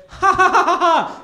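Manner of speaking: high loud cackle, then talk through laughter; mock laughing guard. Scene: TSA lady laughs at his joke, loud high laughter. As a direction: laugh hard, then wave him through. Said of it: "She responded with".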